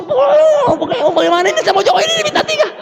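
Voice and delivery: high, pleading whine; frantic whining plea. Retold or imitated. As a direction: pester mum like a desperate kid. He whines at mother.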